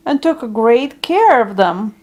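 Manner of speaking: usual speaking voice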